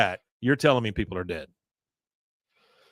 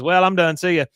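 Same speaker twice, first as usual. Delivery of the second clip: put-on teen boy voice, high and loud; 8 semitones higher, 6 dB louder than usual. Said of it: "Bobby says".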